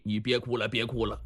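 low, soft consolation; gentle comforting. Acted. Comforting his crying sworn brother.

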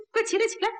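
high, hurried insistence; flustered urging. Acted. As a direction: urge someone quickly to their feet. Hurriedly urging kneeling man up.

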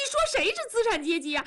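high, fast wounded protest; near tears. Acted. Wife stung by husband's class insult, high tearful protest. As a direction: challenge him, on the verge of crying. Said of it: "文丽要哭了".

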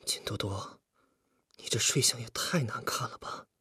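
hushed, half-whispered complaint; inner voice. A narrator's reading. Li Fugui silently complains about the sleeper on his chest, hushed inner voice. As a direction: think it aloud under the breath, very softly. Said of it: "李富贵在心里说着".

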